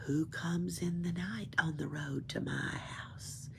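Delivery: slow, hushed, breathy spooky whisper; low spooky whisper. A narrator's reading. Pippi teasing the children at the door, in a slow spooky whisper. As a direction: hiss it low like a ghost behind the door. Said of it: "From inside came a low voice".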